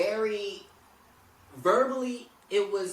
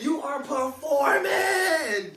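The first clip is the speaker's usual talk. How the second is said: higher, louder, slow laughing shout; 10 semitones higher, 8 dB louder, much slower than usual. Real, not acted. Agreeing with friend that Tara is performing, laughing shout. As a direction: drag it out and laugh it loud. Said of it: "Because you performing".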